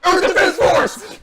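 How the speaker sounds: high, slow, hammed-up shout of the title; mock shout. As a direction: bellow the title like an over-eager fan. Mocking Game Guy's catchphrase.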